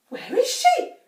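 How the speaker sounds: high, loud panicked cry. A narrator's reading. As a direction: cry out in alarm. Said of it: "cried Missus Cranky".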